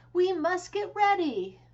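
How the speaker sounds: high, loud eager call to the cows; excited announcement. A narrator's reading. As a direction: announce it like the best news ever. Farmer Brown rallying the cows.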